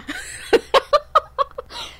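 a squealing high laugh rising up; high giddy laugh. Real, not acted. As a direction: let out a giddy delighted laugh. Gushing about a great week.

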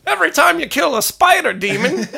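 squeaky movie quote. Pretend. Continues the high Christmas parody.

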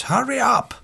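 high-pitched impatient call; retold shout. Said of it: "one of them shouts".

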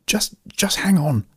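slow down and hold back the panic; rattled but keeping control; strained calm. A narrator's reading. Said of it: "trying to keep herself calm".

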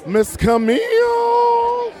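shrieking her name high and long; delighted high cry. Real, not acted. Greeting arriving guest.

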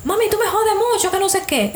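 high-pitched mock complaint to a mother; retold annoyed son. Son answering his mother's where are you going, mock complaint. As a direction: whine back at mum, pitched well up. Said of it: "tú sabes cómo son las madres".

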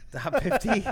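high laughing "top fifty"; laughing disbelief. Real, not acted. Mocking disbelief at claim.

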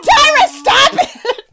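very high, loud, drawn-out wail; hammed-up protest breaking into laughter; mock pleading yell. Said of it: "And it's like".

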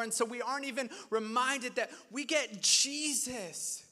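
normal talking voice

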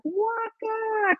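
high called-out name across a crowd; calling out a name. Retold or imitated. Fan calling out to the rapper past security, high call. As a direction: call out to him as he walks past. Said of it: "literally shouting this".

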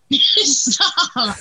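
real giggling fit; laughing. Real, not acted. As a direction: laugh helplessly through the word. Laughing fit after choking.

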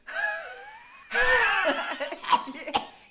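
high, loud, rasping smoker's laugh that won't stop; smoker's cackle. Retold or imitated. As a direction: cackle like a chain-smoking mom. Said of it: "aí ela ri assim".

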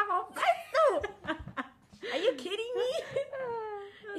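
high, loud, slow laughing disbelief; laughing mock alarm. Retelling her reaction to a crying friend, slow laughing alarm. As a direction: stretch it out through laughter. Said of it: "ich hab gesagt, Saskia".

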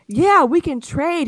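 high, slow wheedling; mock eager scammer. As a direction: sell the bad deal sweetly. Voicing the scammer.

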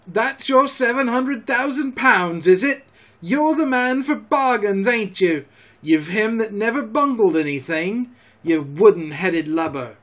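high, scornful outburst; angry roaring. A narrator's reading. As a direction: berate him at the top of your voice. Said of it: "roared Mary".

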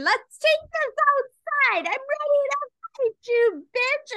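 high mock fighting talk; mock tough challenge. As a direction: mimic a girl picking a fight, shrill and high. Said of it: "she just kept saying".

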